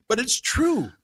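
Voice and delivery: ordinary talk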